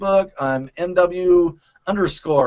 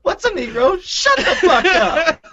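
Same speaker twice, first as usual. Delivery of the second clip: mock quote; 11 semitones higher, 4 dB louder than usual. Quoting a movie line.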